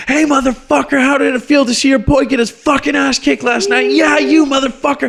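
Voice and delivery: put-on high, loud drunken yelling at a stranger; drunk screaming. Woman screaming at a hockey fan in a bar. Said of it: "stands up and starts screaming".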